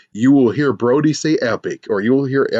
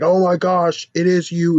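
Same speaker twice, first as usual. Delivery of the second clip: higher mock-amazed voice; awed fan; 6 semitones higher than usual. Transfer student recognizing the star, awed. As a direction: play a mildly starstruck student.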